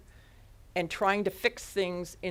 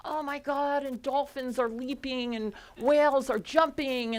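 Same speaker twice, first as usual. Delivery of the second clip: higher-pitched, slowly savoured excitement; awed, excited retelling; retold excitement; 6 semitones higher, slower than usual. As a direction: relive the thrill, drawing out the words. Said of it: "I was so excited, and then I just said".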